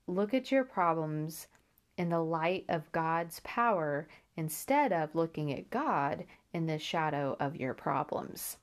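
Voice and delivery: ordinary talk, nothing marked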